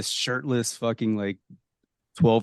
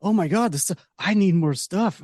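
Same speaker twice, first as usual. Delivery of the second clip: high-pitched, hammed-up urgency; exaggerated pleading outburst; mock frantic craving; 8 semitones higher than usual. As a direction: act out desperate movie characters. Acting out film characters craving the stuff.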